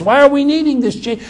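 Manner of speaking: normal talking voice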